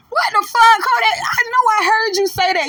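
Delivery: high-pitched, incredulous scolding; outraged disbelief. Real, not acted. Commentator scolding the rapper over his remark, high incredulous outrage. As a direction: call him out, voice shooting up.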